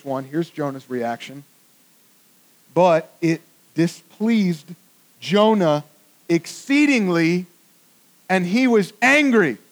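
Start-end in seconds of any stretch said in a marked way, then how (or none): 2.7-9.6 slow, deliberate Bible reading; solemn reading with a rise on angry; scripture reading. Acted. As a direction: read the verse out slow and weighty. Reads scripture verse aloud.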